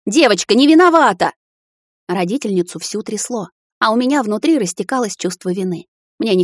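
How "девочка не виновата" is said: high, loud shout. A narrator's reading. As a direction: defend the girl, shouting. Mother shouting at son. (мать защищает девочку, громкий крик)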